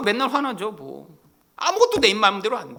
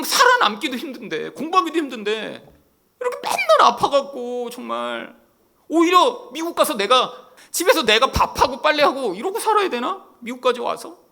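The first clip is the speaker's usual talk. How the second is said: exasperated; 5 semitones higher, 5 dB louder than usual. Retold or imitated. Pastor reenacts his past frustration.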